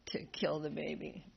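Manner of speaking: ordinary delivery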